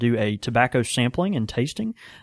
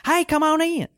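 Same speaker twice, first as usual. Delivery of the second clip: much higher, repeated chirpy hello; mocking his greeting. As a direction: parrot his cheery welcome back at him. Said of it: "they were just like".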